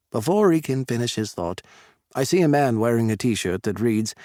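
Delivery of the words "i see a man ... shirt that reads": ordinary delivery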